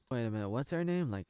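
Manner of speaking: ordinary talk, nothing marked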